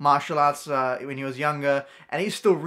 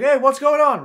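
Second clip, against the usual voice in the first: high, loud imitated holler; calling out; 9 semitones higher, 9 dB louder than usual. Dad comes out of the driveway, calling out loudly. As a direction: act out calling over to strangers.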